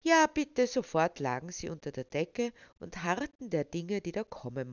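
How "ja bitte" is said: put-on excited child. A narrator's reading. Children eagerly beg for story.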